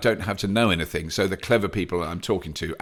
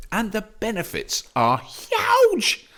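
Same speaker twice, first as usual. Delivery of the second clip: much higher, slow excited shout on huge; over-the-top gushing; hammed-up huge; 12 semitones higher, slower than usual. Shown as a demonstration. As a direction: belt out huge with a laugh. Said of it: "or just normally voiced".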